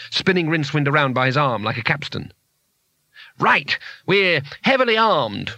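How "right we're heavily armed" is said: slow, high-pitched proclamation; bluffing shout. Acted. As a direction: announce it to scare off a mob.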